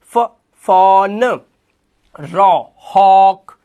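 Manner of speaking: very slow and loud: "फ, फॉन"; drawn-out demo words for the class; slow sounding out. Shown as a demonstration. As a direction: stretch each word so the अ sound is heard. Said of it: "एडब्ल्यू को भी अ से ही पढ़ लो".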